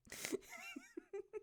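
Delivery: a quiet, very high falling giggle; high soft giggle. Real, not acted. Streamer giggles softly at being timed out. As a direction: let a tiny high laugh fall away.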